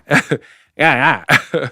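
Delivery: imitated rapper ad-lib; joking hip-hop interjection. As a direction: toss out a punchy mock hype grunt. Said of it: "like a prime [name] with that".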